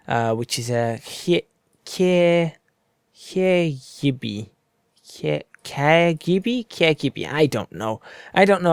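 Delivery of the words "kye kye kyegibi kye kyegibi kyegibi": slow, halting tries at KYEGIBI, stretching each syllable; unsure pronunciation attempt. Real, not acted. Gamer attempting a Welsh place name for viewers, unsure pronunciation. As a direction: sound out KYEGIBI haltingly, once with a questioning rise. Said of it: "don't know how to pronounce everything in Welsh".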